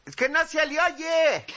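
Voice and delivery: high-pitched call from the crowd; heckler's yell. Acted. Audience member complaining to the official, yelling. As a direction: holler it from the back. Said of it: "Y todavía alguien grita".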